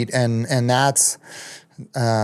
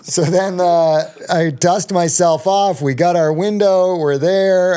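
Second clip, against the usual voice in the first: excited; 7 semitones higher, 3 dB louder than usual. Real, not acted. Excitedly recounting the summit window.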